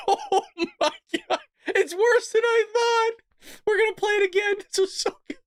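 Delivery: high-pitched, helpless laughing talk; laughing hard. Real, not acted. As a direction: squeal it out through laughter. Cracking up at the played clip.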